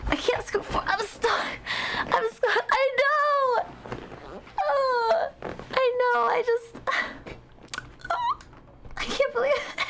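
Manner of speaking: high, a touch of fake whimpering; mock whine. Streamer sulking to chat over the missed show, high mock whine. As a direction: whine it like a sulky child.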